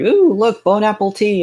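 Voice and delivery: hammed-up glee at a reward; mock delight. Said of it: "campaign tribute reward".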